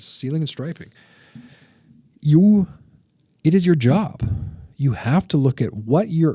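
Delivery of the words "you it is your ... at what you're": high, loud, pointed at the listener; emphatic address. Real, not acted. Coach telling contractors their duty, loud and high. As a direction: call the audience out directly. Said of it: "I'm gonna bring this back down for you guys".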